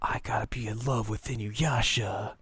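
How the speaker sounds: voiceless whisper, like a secret; whispered line. Retold or imitated. Kagome's confession, whispered under her breath. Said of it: "he's like".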